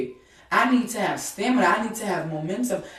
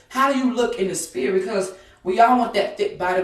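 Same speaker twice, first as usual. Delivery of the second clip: normal talking voice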